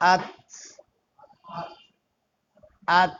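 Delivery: আত ending in a tapped ত with a light bounce; stopped ত. Shown as a demonstration. Teacher shows the stopped ত to students. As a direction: tap the ত at the end of আত. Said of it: "জজম হলো এরকম".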